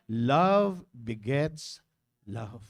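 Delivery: drawn out slow and low, each word weighted; slow emphatic maxim. Real, not acted. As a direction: lay it down slowly like a proverb. (mabagal at mariing pagbigkas ng kasabihan)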